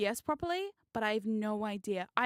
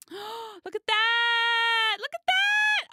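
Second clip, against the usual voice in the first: very high, loud, stretched-out cry; excited squeal; 10 semitones higher, 8 dB louder, much slower than usual. Real, not acted. Streamer shows viewers the video, excited squeal. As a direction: squeal it out, holding the last word long.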